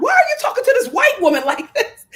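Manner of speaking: high and loud, fast, laughing; incredulous quote. Retold or imitated. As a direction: blurt it out in laughing disbelief. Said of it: "And I'm like".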